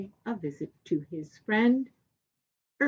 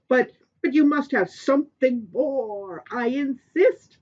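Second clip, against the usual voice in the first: insistent Sky King; 5 semitones higher, 6 dB louder than usual. Acted.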